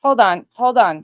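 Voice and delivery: normal talking voice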